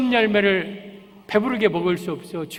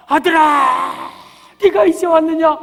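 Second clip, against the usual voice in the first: father calling out; 7 semitones higher, 6 dB louder than usual. Acted. Father spots his returning son far off, calling out. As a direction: call joyfully across a long distance.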